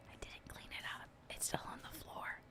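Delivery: hushed unvoiced aside; whispered confession. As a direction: whisper it like a secret admission. Whispered comic confession after joke.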